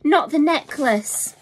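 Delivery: high, loud desperate plea; pleading cry. Acted. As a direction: beg the robber not to take it. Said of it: "Juba cried".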